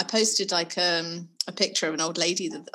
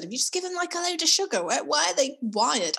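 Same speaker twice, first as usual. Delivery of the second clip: exasperated husband; 7 semitones higher than usual. Retold or imitated. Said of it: "Rich said".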